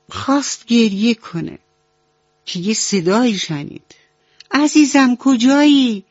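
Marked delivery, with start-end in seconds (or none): 4.5-6.0 higher, louder call from afar, searching; mother cow calling. A narrator's reading. Mother cow calls into the dark for her lost calf. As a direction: call out tenderly for a lost child.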